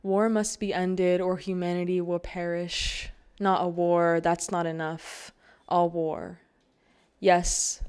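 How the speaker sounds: declaimed poem, firm and even-pitched; poem reading. Acted. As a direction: read the poem out to the audience with emphatic weight. Reads a dedicated poem aloud.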